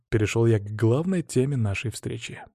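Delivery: ordinary delivery, nothing marked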